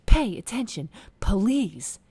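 high, very loud, emphatic order; mock loud demand. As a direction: punch out each word like a demand. Acts out Danielle's sharp demand.

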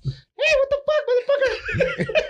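very high, slow squeaky cussing; Mickey Mouse-like squeal; impression of Daniel. Imitates friend Daniel, very high slow squeaky cursing. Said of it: "You sound like Mickey Mouse".

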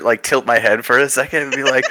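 ordinary delivery

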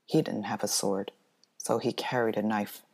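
usual speaking voice

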